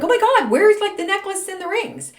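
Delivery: high, loud fake gasp of surprise; mock shock. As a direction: play up shocked surprise at the missing rings.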